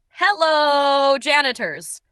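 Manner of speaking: high, loud, drawn-out greeting that sags at the end; dramatic shout. Acted. The janitor shouts his greeting again with extra drama. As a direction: belt it down the hall like a sitcom entrance. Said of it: "shouting again with even more drama".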